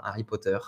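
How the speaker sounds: "Harry Potter" with no H and a final French r; French pronunciation. Shown as a demonstration. Teacher repeating the name, French final r. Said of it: "avec un beau R à la fin".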